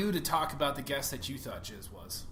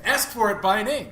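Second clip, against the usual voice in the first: chipper 1950s ad; 7 semitones higher, 10 dB louder than usual. Pretend. Mock ad slogan.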